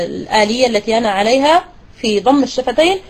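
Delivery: usual speaking voice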